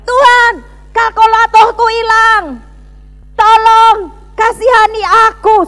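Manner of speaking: loud, high wail for help; shouting to God. Retold or imitated. Broke student who lost a calculator, shouting desperately. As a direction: re-enact a desperate shouted prayer. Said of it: "Saya teriak".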